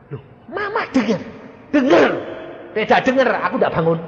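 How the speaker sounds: high, loud teasing denial, a laugh at the end; playful mother. Retold or imitated. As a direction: tease the doctor. Mother's playful reply retold. (menirukan ibu yang bercanda, nada tinggi)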